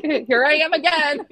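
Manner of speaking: sheepish retold singsong; laughing self-mockery. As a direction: announce it through giggles. Laughing at own repeat predicament.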